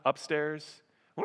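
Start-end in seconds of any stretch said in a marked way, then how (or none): 1.2-1.2 long imitated scream of panic; hammed-up shriek; mock scream. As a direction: wail as if spooked in the bathroom. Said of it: "you hear screaming in your bathroom".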